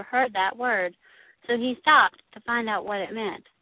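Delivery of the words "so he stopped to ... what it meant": usual speaking voice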